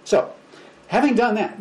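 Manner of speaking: usual speaking voice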